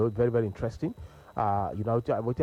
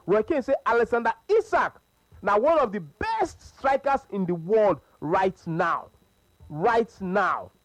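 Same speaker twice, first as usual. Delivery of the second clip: ONE OF THE BEST drawn out slowly and pitched up; emphatic quote; 5 semitones higher, slower than usual. Retold or imitated. Radio host relaying Roy Keane's claim to listeners, emphatic. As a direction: stretch "one of the best strikers" for big emphasis.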